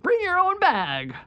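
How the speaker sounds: high, slow, booming promo voice; monster truck announcer. Shown as a demonstration. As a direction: hype it like an arena ad. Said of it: "the monster truck".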